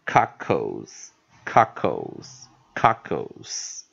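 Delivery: COCKLES very slowly, in two separated syllables; slow pronunciation demo. Shown as a demonstration. Teacher repeating the marked-up word, slow pronunciation demo. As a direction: model COCKLES as cock-ols, pausing after each. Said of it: "那这时候呢".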